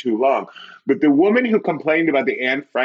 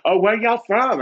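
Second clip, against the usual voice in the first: high-pitched imitated Southern accent; 12 semitones higher than usual. Woman greeting the fake Southerners, imitated Southern accent. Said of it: "the woman had a Southern accent".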